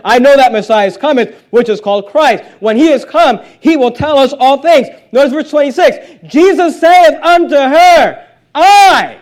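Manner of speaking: building to a high, loud pulpit cry; climactic scripture preaching. Acted.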